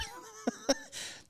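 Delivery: high breathy burst of laughter; real laugh. Real, not acted. Laughs at soap complaint punchline.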